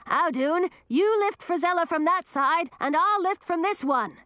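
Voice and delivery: high-pitched urgent instructions; yelling. A narrator's reading. Man organising the rescue to his helper, yelling. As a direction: yell orders to the helper. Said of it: "yelled Edric".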